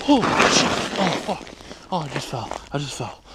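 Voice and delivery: high, loud shocked swearing; startled; frightened cursing. Real, not acted. Something startles him.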